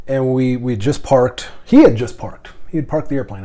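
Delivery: normal talking voice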